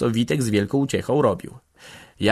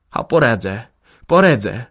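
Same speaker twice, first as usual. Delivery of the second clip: emphatic, determined voice; whispered forcefully; 3 semitones higher, 8 dB louder than usual. A narrator's reading. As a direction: vow it with mounting force. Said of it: "szepnęła z mocą".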